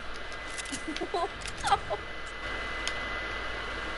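high, quiet breathy laugh. Real, not acted. Player laughing after a cursing outburst, quiet high laugh.

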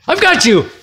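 sudden loud, high pounce; jump-scare shout. Acted. Storyteller springs the scare on the listener, sudden shout. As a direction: burst out to make the listener jump.

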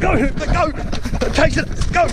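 high, loud shouts urging the dog on; excited yelling. Real, not acted. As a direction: holler at the dog to chase.

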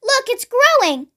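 put-on high, excited boy calling to grandma; excited yell. A narrator's reading. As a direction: call up eagerly, pitch jumping high. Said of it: "he yelled".

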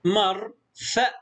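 مرفأ slowly, as "mar ... fa"; split into syllables. Shown as a demonstration. Teacher sounds out a new word, syllable by syllable. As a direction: pause inside مرفأ after "mar". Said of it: "مقطع ساكن".

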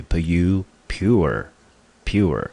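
"pu-" then "pure", the U said as "you"; pronunciation demo. Shown as a demonstration. Teacher showing learners how pure is read, pronunciation demo. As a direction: model "pure" as one syllable with a long "you" sound. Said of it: "本身就是念成U，".

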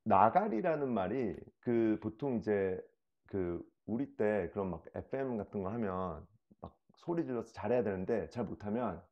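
ordinary talk

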